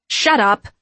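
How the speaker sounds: loud, curt retort; spat back. A narrator's reading. Winifred snapping at Mary, spitting it back. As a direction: spit it out irritably. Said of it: "Winifred spat back".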